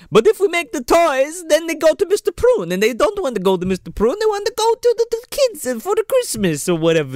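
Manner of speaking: put-on high, pleading man-child voice; childlike Jonathan voice. Friends mock Jonathan to the podcast, high whiny. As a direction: whine it like an upset overgrown kid. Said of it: "Jonathan's like".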